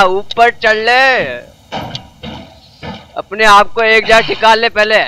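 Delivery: ordinary talk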